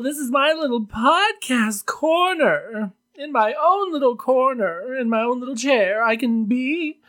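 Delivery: high, showy drag diva voice; campy drag queen. Acted. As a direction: ham it up like a drag queen host. Podcast host persona intro.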